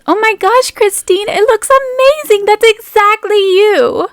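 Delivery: high, thrilled friend gushing over the dress; gushing Meg. Acted. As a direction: squeal your delight at Christine's gown. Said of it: "And she says".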